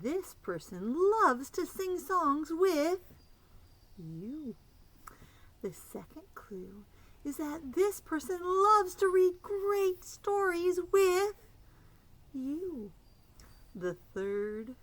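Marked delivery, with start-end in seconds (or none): 0.0-4.5 slow, lifted storytime voice; clue reading. Acted. Storytime reading clues to kids. 5.6-6.8 whispered clue intro. Acted. 7.2-12.9 high, loud, slow storytime voice; excited clue reading. Acted. Storytime reading clues to kids.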